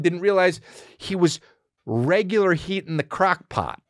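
normal talking voice

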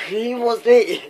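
high, loud startled yelp; excited shriek. Real, not acted. Gamer startled by an enemy, shrieking high and loud. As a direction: blurt it out in a high excited squeal.

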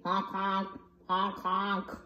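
low soft goose honks. Acted. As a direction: honk like a goose. Goose honking.

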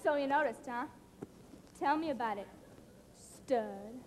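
low, soft come-on; cool, sultry flirting. Acted. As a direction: purr it low and quiet, sure of yourself. Cool flirting after a compliment.

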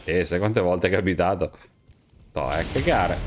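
ordinary talk, nothing marked

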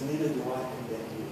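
Jesus forgiving the woman, soft and tender; gentle Jesus. Acted. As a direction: let it go quietly, full of mercy. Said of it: "the gentle spirit of Jesus".

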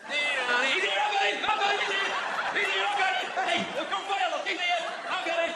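high, loud mock dad bellowing to the police; a hammed-up dad calling out to a passing police car; dad yelling. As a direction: shout like a dad showing off his catch. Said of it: "Your dad ever do that".

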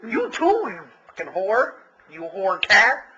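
a cat sassing its owner; mock cat talking back. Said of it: "she goes".

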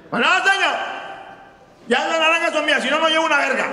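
put-on squeaky kid talking back in a high voice. Costeño kid answers loudly.

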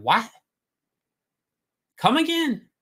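as if he couldn't believe what he just heard; stunned disbelief. Retold or imitated. To Neil Adams on the panel, jaw-dropped disbelief. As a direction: pitch shoots way up on a startled what.

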